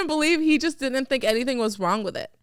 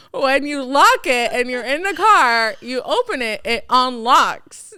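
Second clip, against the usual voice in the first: slow, louder, laughing through it; 5 dB louder, slower than usual. Retold or imitated. Wife explaining the obvious to her husband, laughing slowly. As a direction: explain it while cracking up. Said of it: "I'm like".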